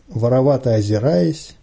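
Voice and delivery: plain reading voice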